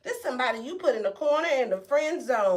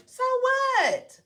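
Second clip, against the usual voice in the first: "So what?" jumps much higher and louder, with a falling end; emphatic so what; 10 semitones higher, 4 dB louder than usual. Shown as a demonstration. Shrugging off the cheaters for the viewer, "So what?" high and loud. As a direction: throw "So what?" up high and hard. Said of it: "So what they shitted on you?".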